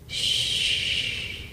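soft whispered shush; breeze shush. A narrator's reading. The breeze rustling leaves, whispered shush. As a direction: hush like wind in the leaves. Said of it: "breeze rustling the leaves".

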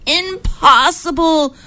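high, slow, drawn-out protest; exasperated disbelief. A narrator's reading. Moshe despairs at ten minutes.

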